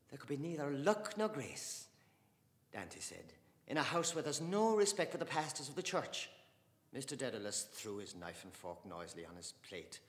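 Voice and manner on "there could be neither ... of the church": a hint of a stern pious aunt; stern Dante. Dante rebuking the father at table, stern. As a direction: scold with pious disapproval.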